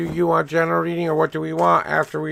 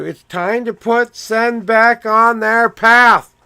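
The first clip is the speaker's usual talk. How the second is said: louder, higher and slow, like a message coming through; intense proclamation; 6 semitones higher, 6 dB louder, slower than usual. Real, not acted. Reading Sen's cards aloud, a loud slow proclamation. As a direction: declare it with force, word by word.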